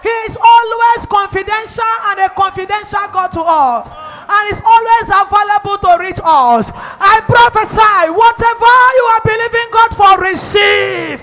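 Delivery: loud, high declaration to the congregation; fervent, booming prophetic proclamation; full-force preaching. Real, not acted. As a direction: preach it out at the top of your voice.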